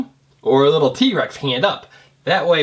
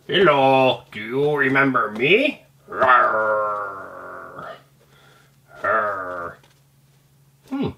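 lower, slow friendly dinosaur, lightly voiced; dinosaur dad voice; 6 semitones lower, much slower than usual. Parent voicing Butch's dad toy to kids, low playful dinosaur.

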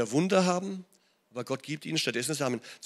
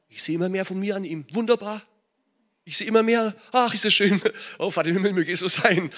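delighted father; 4 semitones higher, 6 dB louder than usual. Retold or imitated. God delighting in his child.

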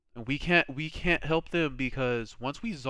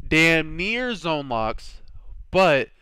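emphatic; 4 semitones higher, 6 dB louder, much slower than usual. Real, not acted. Emphatic game recap.

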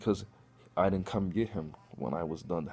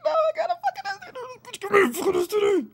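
squeaky, much higher panicked voice with a laugh; high strained panic; 5 dB louder than usual. Retold or imitated. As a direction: squeal it out like your throat is burning. Throat hurting, panicked realization.

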